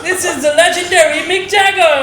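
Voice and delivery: slow grand announcer voice. Pretend. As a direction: hype him up like a host. Mock announcing [name].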